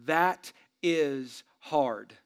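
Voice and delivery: "that is hard" drawn out very slowly; slow emphasis. Real, not acted. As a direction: lean on each word, slow. Pastor stressing marriage difficulty.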